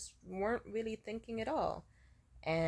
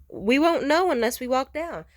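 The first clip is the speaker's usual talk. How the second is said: loud, higher, insistent retort; exasperated; 5 semitones higher, 11 dB louder than usual. Retold or imitated. To the dithering date about the hill, loudly exasperated. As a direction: push back hard at the dithering.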